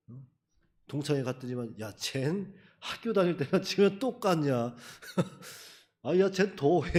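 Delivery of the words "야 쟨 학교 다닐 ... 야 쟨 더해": high, drawn-out classmate's gibe with laughter; pitched-up playful mockery; mock disbelief. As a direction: imitate a scoffing old schoolmate, amused. Mimics classmates mocking an unchanged friend.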